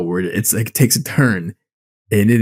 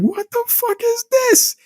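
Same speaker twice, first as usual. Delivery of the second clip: slow, very high comic disbelief; incredulous squeal. Retold or imitated. Friend retelling an hour without sharks, incredulous squeal. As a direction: draw it out in squeaky mock bewilderment. Repeated baffled reaction to film.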